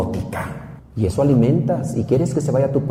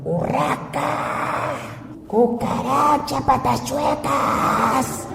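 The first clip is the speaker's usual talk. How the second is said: very high, slow mock drunken insults; drunk husband yelling; 12 semitones higher, much slower than usual. Drunk husband insults his wife, very high and drawn out. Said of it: "gritándole".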